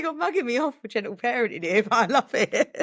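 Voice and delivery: laughing through the words, voice up high; amused mum telling her son off while laughing. Real, not acted. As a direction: giggle it out fondly.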